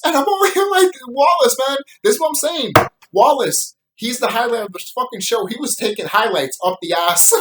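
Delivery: hyped fan praising a favourite character; excited. Real, not acted. Vlogger raving to viewers about Wallace, excited.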